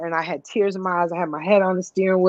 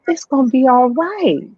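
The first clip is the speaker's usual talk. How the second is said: put-on little boy comforting; 9 semitones higher than usual. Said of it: "little Caleb comes and puts his hand".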